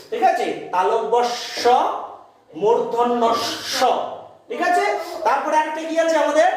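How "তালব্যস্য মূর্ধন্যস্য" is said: মূর্ধন্যস্য drawn out slowly, each sound clear; careful pronunciation. Shown as a demonstration. Teacher modelling the sibilants for pupils, slow and careful. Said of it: "স্বয়ের উচ্চারণ ঠিকঠাক করতে হবে".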